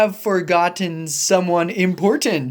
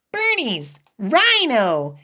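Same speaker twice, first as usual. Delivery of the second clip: ordinary delivery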